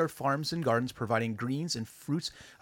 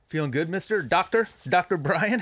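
playful; 6 semitones higher, 6 dB louder than usual. Acted. Playful greeting to guest.

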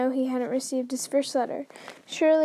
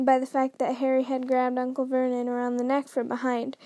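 plain reading voice

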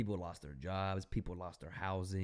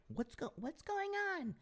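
much higher, softer, slower voice of the store worker; 4 dB quieter than usual. Retold or imitated. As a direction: squeak it out like the flustered clerk. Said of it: "She was like".

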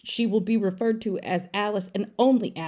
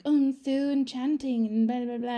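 mock swoony heroine; 6 semitones higher than usual. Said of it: "she's like".

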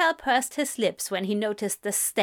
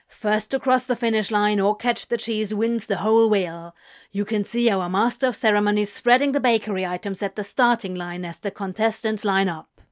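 louder, brisk broadcaster read; news report; 4 dB louder than usual. A narrator's reading. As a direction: present it properly to camera. Decent TV report.

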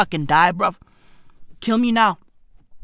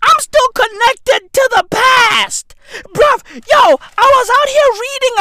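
shouting; 10 semitones higher, 4 dB louder than usual. Acted. Comic rant opening, shouting.

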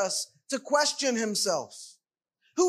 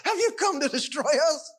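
laughing taunt, amused and scornful; mocking with a laugh; 3 semitones higher, faster than usual. Shown as a demonstration. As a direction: laugh at him as you ask. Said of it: "read it as the Spirit mocking Jesus".

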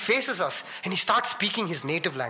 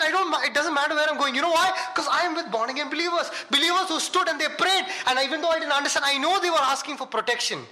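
high-pitched, elated testimony; joyous; 9 semitones higher than usual. Retold or imitated. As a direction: retell it bursting with joy, pitched high. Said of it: "I was so joyous".

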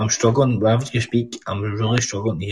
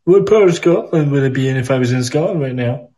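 slightly higher, mocking typed-out question; mock search query; 3 semitones higher than usual. Scot mocks friend supposedly Googling Scotland, mock search query. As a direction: read out a pretend Google search, teasing. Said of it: "As you're typing it to Google".

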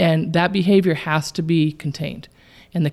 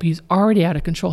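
ordinary delivery

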